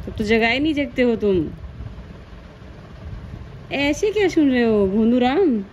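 mother teasing her wide-awake newborn. Real, not acted. Mother calling her baby playfully.

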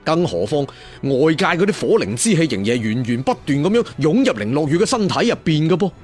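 ordinary delivery, nothing marked

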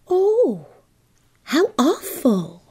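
high, breathy horror at gossip; gasp, then draw it out, high and shocked; scandalized gasp. A narrator's reading. Girl reacts shocked to gossip.

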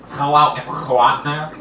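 slow, garbled prank-caller voice; drawn-out, hard-to-understand caller; muffled voice. Shown as a demonstration. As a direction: redo the muffled prank call. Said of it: "Did he sound like this?".

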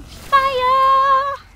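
slow build to a high shout; triumphant yell. Pretend. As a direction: stretch the last word into a holler. Triumphant yell after kill.

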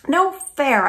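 high, loud protest yell. Acted. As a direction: yell it out while racing. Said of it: "I yell".

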